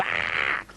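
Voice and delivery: short, loud demonstration scream; mock scream. Therapist screaming at the patient in the ward, mock scream. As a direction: let out one harsh "ah". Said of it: "And I screamed".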